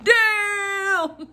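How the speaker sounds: high, drawn-out cry through laughter; amazed exclamation. Retold or imitated. Clerk reacts to manager defending her, amazed and laughing. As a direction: whoop it, stretched and cracking up.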